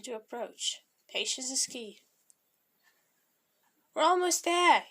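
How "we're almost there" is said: higher, louder cheerful announcement. A narrator's reading. Young to his companion near the statue, cheerful. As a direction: call it out cheerfully. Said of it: "Young said cheerfully".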